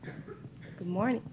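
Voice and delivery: barely audible, mic too far; soft, distant hello; faint greeting. Real, not acted. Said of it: "Can you hear me?".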